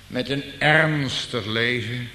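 ordinary delivery

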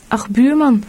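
ontzet uitgeroepen, hoger; dismayed cry. A narrator's reading. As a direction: geschrokken naar zijn buurman in de modder. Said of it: "riep Gezeggelijk onthutst". (ontzet: Ach, buurman)

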